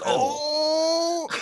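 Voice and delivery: high drawn-out broadcaster's "oh"; hammed-up high mock moan of awe; Tony Romo. Podcaster mimicking a football commentator, hammed-up high voice. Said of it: "That's the best impression".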